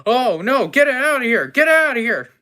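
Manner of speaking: loud, high, frantic shout; alarmed shouting. Acted. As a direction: shout to drive the critter away. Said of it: "I look at it and say".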